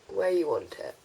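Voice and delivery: ordinary talk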